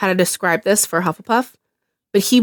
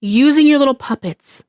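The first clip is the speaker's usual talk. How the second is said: normal talking voice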